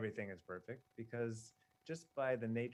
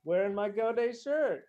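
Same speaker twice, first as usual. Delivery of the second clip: higher, louder proud reveal; excited; 10 semitones higher, 10 dB louder than usual. Real, not acted. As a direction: show it off with pride. Showing off new shirt.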